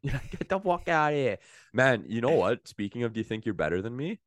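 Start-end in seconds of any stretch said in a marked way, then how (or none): 0.0-1.4 high, laughing Boston brush-off; playful hammed-up Bostonian; Bostonian accent. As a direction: laugh out a mock Boston retort. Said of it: "My favorite is the Bostonian".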